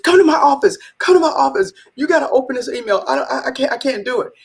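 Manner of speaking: high, breathless-sounding rush of excitement; excited urgency. Retold or imitated. Boss bursting into COO's office, high excited plea. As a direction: call him in, too excited to open it. Rushing to COO with email.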